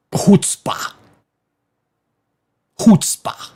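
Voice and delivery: "chutzpah" breathed out as HUTS-pah, voiceless throaty start; "chutzpah" with a rasping Yiddish ch and a hint of breath. Shown as a demonstration.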